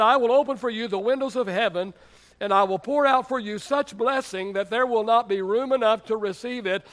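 loud, emphatic preacher quoting scripture; God's proclamation. Acted. Pastor quotes God's promise to tithers, loud proclamation. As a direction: proclaim the promise at full force. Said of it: "No, he said".

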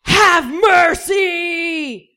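very slow, high, loud catchphrase shout; drawn-out booming catchphrase; thundering shout. Acted. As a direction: bellow it across the room. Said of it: "thundering throughout the room".

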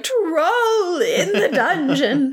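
high, drawn-out mock alarm cry; Professor Quirrell. Professor bursting in to warn everyone, high hammed-up alarm. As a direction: wail it slowly, laughter creeping in. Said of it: "Professor Quirrell comes in and he's like".

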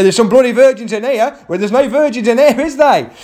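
high, loud mocking jab; cheeky punchline. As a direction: land the gag on the crowd. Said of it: "And I say".

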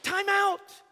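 loud, high call for a halt; Jesus halting the blame game; firm interruption. Acted. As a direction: stop him short. Jesus cuts off the complaint.

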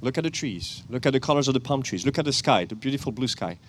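ordinary talk, nothing marked

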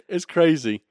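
ordinary delivery

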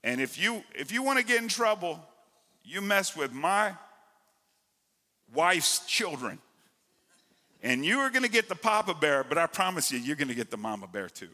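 high, loud preaching warning; fiery preaching. Acted. Preacher warns about mama bear.

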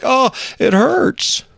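put-on high, slow child's whimper; hammed-up hurt kid, drawn out; mock crying. Son crying to dad over his foot, mock crying. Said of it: "And he's crying".

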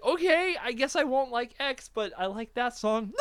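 high, whiny giving-in; mock plaintive concession. Conceding to his friend about the song, mock plaintive concession. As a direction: give in with a squeaky, wounded protest.

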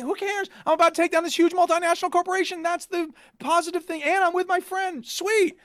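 high-pitched, breezy self-quote; retold upbeat shrug. Player glad to be with their friend, high upbeat dismissal. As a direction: brush it off in a high, pleased voice.

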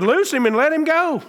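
high, ringing command to the dead; commanding Jesus. Retold or imitated. Preacher voicing Jesus commanding at the tomb, high and ringing. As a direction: call him out of the tomb, high and full. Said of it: "Jesus said to him".

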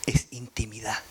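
hushed whisper on intimacy. Real, not acted. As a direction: breathe the words softly. (susurro íntimo)

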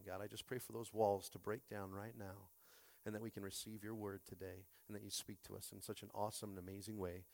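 soft, earnest spoken prayer. Real, not acted. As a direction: pray quietly over the congregation. Pastor leads opening prayer.

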